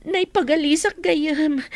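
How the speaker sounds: ordinary delivery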